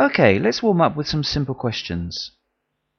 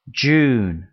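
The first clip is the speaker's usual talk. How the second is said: usual speaking voice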